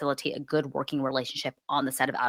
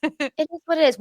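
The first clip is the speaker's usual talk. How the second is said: mocking; 12 semitones higher, 4 dB louder than usual. Cohost admits saying it.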